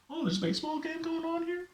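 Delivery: high, startled mock surprise; clueless surprise. Clueless outfielder noticing the game, high startled surprise. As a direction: act suddenly waking up to the game. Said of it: "I'm like".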